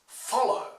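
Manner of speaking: 'Follow' with strong FOL, lighter ending; first-syllable stress. Shown as a demonstration. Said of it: "stressed on the first syllable".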